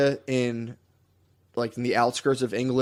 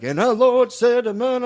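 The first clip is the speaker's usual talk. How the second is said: much higher, louder, slow preacher cry; overanimated preacher; 11 semitones higher, 5 dB louder than usual. Retold or imitated. Podcaster mocks old Southern preachers, hammed-up preacher voice. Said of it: "that overanimated type sh".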